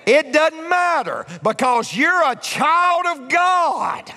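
slow, high, loud preacher's cry; preaching shout. Real, not acted. Pastor proclaiming to the congregation, a slow loud shout. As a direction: drive it home to the congregation at full force. Preacher driving home the point.

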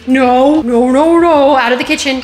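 loud no no no, shooing; shooing shout. Real, not acted. Shouting at the dog to leave the kitchen, loud. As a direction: drive the pet out loudly.

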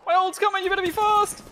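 urgent callout. Real, not acted. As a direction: call it out again to hurry him. Repeats callout more urgently.

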